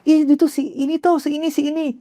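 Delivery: high, excited naive pointing out; naive excited blurt. Retold or imitated. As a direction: blurt it like a starstruck kid. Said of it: "dengan polosnya ngomong begini".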